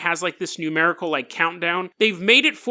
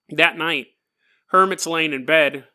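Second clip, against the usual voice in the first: ordinary delivery, nothing marked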